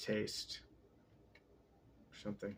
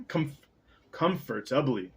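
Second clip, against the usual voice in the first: ordinary delivery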